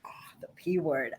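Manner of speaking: hushed whisper, the naughty word; conspiratorial whisper about the P word. Real, not acted. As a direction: whisper it like a secret. Guest echoes the taboo topic.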